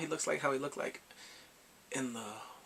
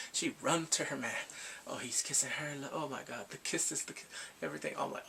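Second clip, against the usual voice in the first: normal talking voice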